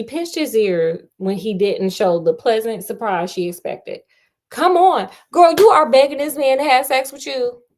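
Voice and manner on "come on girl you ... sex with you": loud, higher, fed-up scolding; exasperated outburst. Real, not acted. Host scolding the pushy heroine, loud and fed up. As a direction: yell at the heroine to stop.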